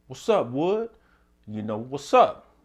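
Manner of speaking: high, loud callout to a fellow Wood; a challenging shout of "what's up"; calling out. Retold or imitated. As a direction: hype up the crew, ready to ride. The Woods calling out, ready.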